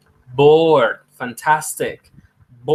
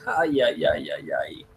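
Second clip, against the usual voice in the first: mock moan from heat; 3 semitones lower, 6 dB quieter than usual. Sweltering hot afternoon.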